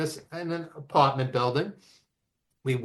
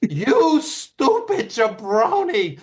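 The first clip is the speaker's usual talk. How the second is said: high, loud, slow wrestling-promo rant; high, loud mock promo rage; Sheik flipping out; 8 semitones higher, 4 dB louder, slower than usual. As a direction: cut a promo at the poor fan. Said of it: "And he goes / he flips out".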